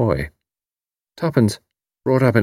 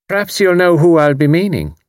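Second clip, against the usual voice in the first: Irish landlady; 9 semitones higher, 4 dB louder than usual. A narrator's reading. Mrs O'Rourke voiced.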